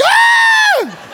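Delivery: a high shriek, half laughing; startled scream. Retold or imitated. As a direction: shriek in mock horror. Repeating his shocked reaction.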